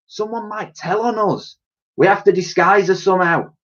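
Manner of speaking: high-pitched character voice, thinking it through; stumped lady. A narrator's reading. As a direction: pitch it up, working out a problem.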